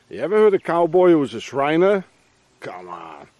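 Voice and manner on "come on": ordinary delivery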